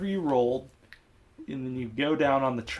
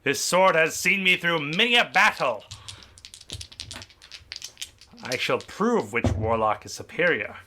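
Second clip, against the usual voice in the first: skeleton warrior; 3 semitones higher than usual. Acted.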